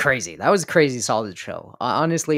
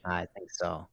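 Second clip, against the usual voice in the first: much softer, lower; quiet; 5 semitones lower, 6 dB quieter than usual. Real, not acted. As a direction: drop to a hush. Drops voice suddenly.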